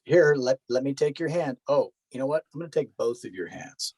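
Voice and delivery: pandering. Retold or imitated. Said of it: "is dumb and panders, um, and says".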